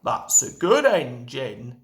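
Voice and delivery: a light gruff Fat Controller, kind; kindly Fat Controller. A narrator's reading. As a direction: pat him on the back with your voice. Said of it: "said the Fat Controller kindly".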